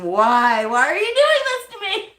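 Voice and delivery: high-pitched, wailing retold scream; mock scream. Woman pleading with her attacking rooster, mock screaming. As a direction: climb into a hammed-up plea. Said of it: "and I'm screaming".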